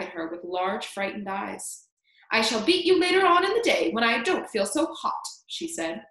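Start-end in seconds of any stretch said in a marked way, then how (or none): 2.3-5.3 high, loud scolding; cross aunt's loud warning; angry threat. A narrator's reading. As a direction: threaten the child with raised voice. Aunt threatens the boy.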